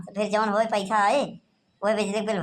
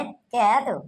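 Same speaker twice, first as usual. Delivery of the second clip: ordinary talk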